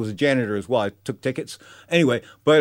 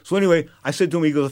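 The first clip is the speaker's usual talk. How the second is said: ordinary talk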